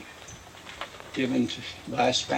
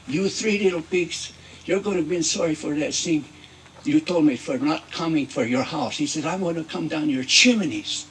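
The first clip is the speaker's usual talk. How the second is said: put-on loud, threatening wolf with a Mexican accent; mad wolf; 5 dB louder than usual. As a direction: threaten the pigs, loud and mock-angry. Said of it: "this makes the big bad wolf very mad".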